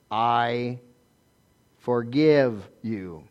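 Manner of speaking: grave, measured pardon; solemn forgiveness. Shown as a demonstration. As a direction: say each word slowly and sincerely. Models forgiving someone who wronged you.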